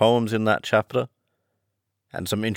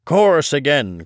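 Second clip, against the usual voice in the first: louder, higher call for the chorus; cried out; 6 semitones higher, 5 dB louder than usual. A narrator's reading. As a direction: call out eagerly to the turtle. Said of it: "cried the Gryphon".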